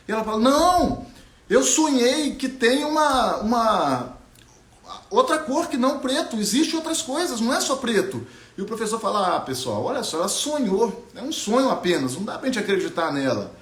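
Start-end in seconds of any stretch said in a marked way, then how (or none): 0.4-8.3 put-on higher, earnest girl's voice pleading her case; a girl who saw colour, insisting; young Ariana insisting. Ariana insists to her teacher, higher and earnest.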